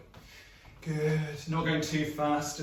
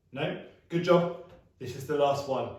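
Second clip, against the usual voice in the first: ordinary talk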